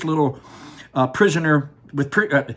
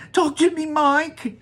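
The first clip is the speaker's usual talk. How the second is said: anxious mother; 13 semitones higher, 4 dB louder than usual. Acted. Mother pleading with her son.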